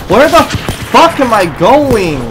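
loud, high, drawn-out yell; angry shout. Real, not acted. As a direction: shout it in disbelief. Raging at lag.

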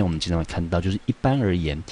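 normal talking voice

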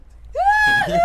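shrieking burst of laughter; squealing laugh; loud high laugh. Real, not acted. Laughing at the vague answer. (yüksek sesle kahkaha)

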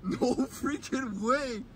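high, soft, slow disbelief; hushed, stunned no way. Real, not acted. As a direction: let it out slowly, hardly believing it. Stunned by the jets' force.

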